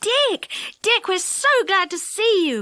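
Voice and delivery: high, relieved calling out; children crying out with relief; glad cry. A narrator's reading. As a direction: cry out with joy at his return. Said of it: "cried everyone".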